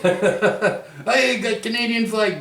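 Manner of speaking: laughing high through the words. Real, not acted. Showing viewers a Canadian flag photo, laughing. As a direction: chuckle the words out, amused.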